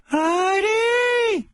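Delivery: screeching falsetto shout of Heidi; High Pitch Eric yelling. Retold or imitated. Heckler shrieking at judge Heidi from the audience. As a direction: bellow the name in a squeal.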